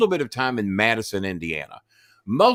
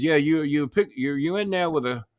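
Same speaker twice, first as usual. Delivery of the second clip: Southern general; 5 semitones higher than usual. Retold or imitated. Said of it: "talks like a, s-, a Southern general".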